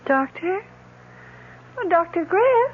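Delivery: bewildered cry for the doctor; confused alarm. Acted. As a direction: call out lost and scared. Patient wakes confused.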